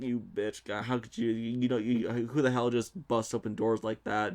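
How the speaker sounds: usual speaking voice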